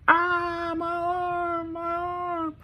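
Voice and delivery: very high falsetto wail of pain; hysterical cry. Acted. Tucker's arm burnt by chemicals, hysterical high wail. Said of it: "cries out hysterically".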